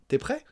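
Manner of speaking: "prêt" lifted into a rise; question intonation. Shown as a demonstration. Teacher adding a question mark, rising on "prêt". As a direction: turn "t'es prêt" into a question with a final rise. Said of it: "On ajoute un point d'interrogation".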